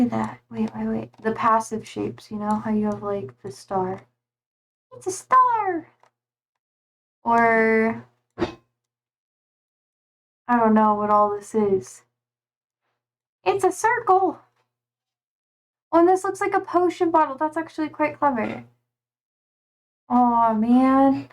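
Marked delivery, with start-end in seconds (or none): 5.0-5.9 high excited exclamation; delighted discovery of a star. Real, not acted. 13.4-17.4 high excited exclamation; delighted discovery of a circle. Real, not acted.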